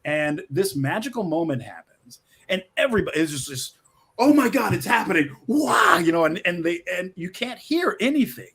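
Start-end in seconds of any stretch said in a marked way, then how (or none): 4.2-6.0 awed gasp. Retold or imitated. Audience awed at movie moment.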